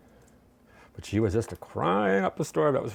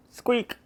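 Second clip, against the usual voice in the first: toy squeak; 12 semitones higher than usual. Retold or imitated. Imitating the dog's toy.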